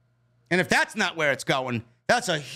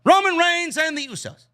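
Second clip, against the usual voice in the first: loud announcement; 9 semitones higher, 5 dB louder than usual. Real, not acted. Announcing next segment loudly.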